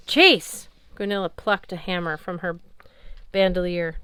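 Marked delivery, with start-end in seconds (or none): 0.1-0.7 high, loud bark of a name; sharp shout. Acted. Valkyrie captain shouting at Magnus across the field. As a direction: call him out hard.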